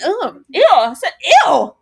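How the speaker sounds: high loud disgusted shriek; retold disgusted scream. Woman recoiling from a man on TV, shrieking. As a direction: screech ew in disgust.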